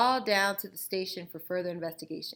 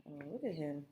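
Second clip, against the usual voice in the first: soft unvoiced aside at the cover; whispered oh look at him; 3 semitones lower, 9 dB quieter, faster than usual. Real, not acted. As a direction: breathe it out, amused.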